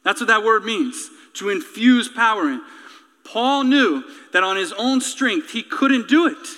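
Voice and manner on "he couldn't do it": ordinary delivery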